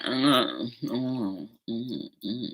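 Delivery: mock smacking chew sounds; chewing noises. Shown as a demonstration. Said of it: "You know how they go chewing like".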